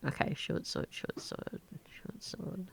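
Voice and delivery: very soft, half-whispered mumble; muttering to self. Real, not acted. Game master noting a short sword, muttering to self. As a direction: think aloud under your breath.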